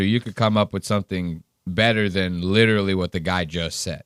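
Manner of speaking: ordinary delivery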